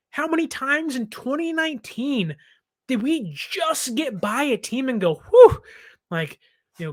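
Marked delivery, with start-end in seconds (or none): 5.2-5.6 big loud high sigh of relief; relieved whew. Retold or imitated. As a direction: blow out the relief after a narrow escape. Relief after a close win.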